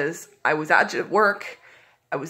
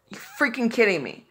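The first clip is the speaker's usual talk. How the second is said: ordinary talk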